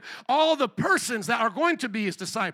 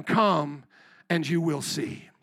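gentle invitation; 7 semitones lower, slower than usual. Retold or imitated. Jesus's gentle invitation to disciples.

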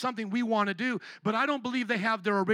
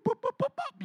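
high squeaky boops. Retold or imitated. Preacher mocking the claims with squeaky boops. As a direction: pop them off like bubbles.

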